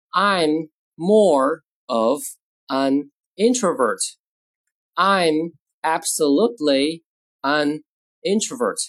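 very slow, drawn-out words; slow model reading. Shown as a demonstration. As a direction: spell out the sentence word by word for learners. Teacher models sentences word by word.

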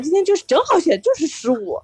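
usual speaking voice

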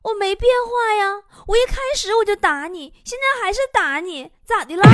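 high, brazen retort; self-righteous wife. Acted. Wife defends herself to husband, high and unapologetic. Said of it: "媳妇儿理直气壮地说".

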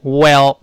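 loud, clear "well，well" with an open e and no curled tongue; correct well. Shown as a demonstration. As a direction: model "well，well" crisply. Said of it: "再读一遍".

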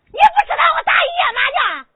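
high, loud indignant yell, stress on 不; angry yell. Acted. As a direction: throw 不知道 at him in outrage. Wife yelling about all-night mahjong.

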